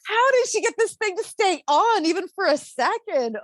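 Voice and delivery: high, incredulous ranting questions; exasperated disbelief. Retold or imitated. Mom reacting to babysitter's backwards diaper, exasperated disbelief. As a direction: rant in bafflement, a laugh slipping in.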